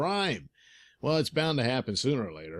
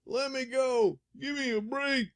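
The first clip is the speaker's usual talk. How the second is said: pleading crook, pitched up; high thug voice; 13 semitones higher than usual. Acted. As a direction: whine like a caught thug.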